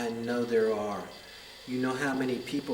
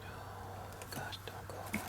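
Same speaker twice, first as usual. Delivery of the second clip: urgent whisper; 20 dB quieter than usual. Real, not acted. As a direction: breathe it out, barely voiced. Pleading as the spirit fades.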